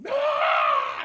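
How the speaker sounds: deep mock roar of a charging man; low monster shout. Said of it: "He hollered".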